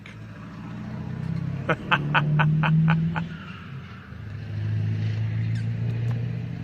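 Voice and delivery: long unvoiced laugh at his own joke; long breathy laugh. Real, not acted.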